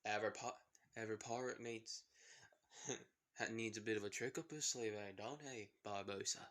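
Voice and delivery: softly voiced roguish pirate impression; quiet attempt at a tricky pirate captain; Jack Sparrow. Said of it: "I try to do my best as Jack Sparrow".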